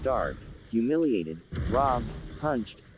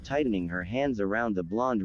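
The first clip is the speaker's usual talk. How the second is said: ordinary delivery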